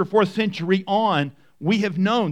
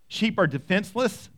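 usual speaking voice

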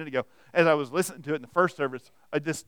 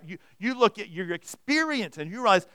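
ordinary talk

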